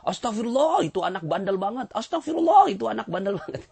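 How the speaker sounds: high, loud mock-indignant outburst; scandalised, high-pitched complaint, hammed up; exasperated blaming. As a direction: imitate a parent exclaiming over a naughty child. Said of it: "tapi ngomongnya gini".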